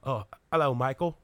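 exaggerated British accent; Henry Cavill. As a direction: play a polite British film star. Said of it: "You do Henry Cavill".

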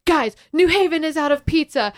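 high, loud mock-alarmed announcement; retold rallying speech. Film-maker addressing crowd by the front door, retold yelled speech. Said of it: "giving them this, like, speech".

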